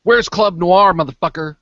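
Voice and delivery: acted shouted demand; confronting demand. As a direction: call it out as a challenge. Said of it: "run around to the front and I go".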